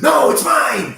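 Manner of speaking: high, loud grabbing shout; possessive shout. Acted. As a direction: scream to claim the pouch. Dwarves fight over pouch.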